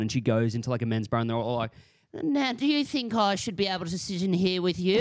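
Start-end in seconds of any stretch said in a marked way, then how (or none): 2.2-5.0 put-on high, prim old-timey voice; like a fifties newsreel lady; old newsreel reporter. Acting archival reporter in men's bar.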